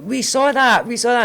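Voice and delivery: high loud teasing shout; friends shouting. Retold or imitated. Friends on group chat shouting at mum, teasing. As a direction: yell it like caught red-handed. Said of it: "the guys all started shouting".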